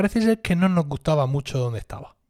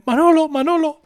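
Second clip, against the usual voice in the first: high, loud urgent call of a name; 12 semitones higher, 5 dB louder than usual. Retold or imitated. Friend calling with injured finger.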